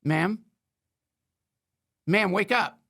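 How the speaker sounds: calling out. Acted. Cop role-play, rousing a driver.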